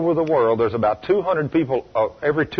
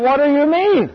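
high, loud, startled retort; imitating an affronted caller, pitched way up; indignant shock; 13 semitones higher, 5 dB louder than usual. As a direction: act out a shocked woman's comeback. Woman startled at being screamed at.

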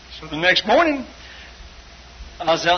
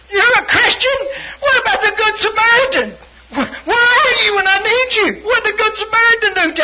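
high, shouted, whiny imitation of brother; hammed-up wailing accusation; 7 dB louder than usual. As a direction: wail it like an aggrieved, needy brother.

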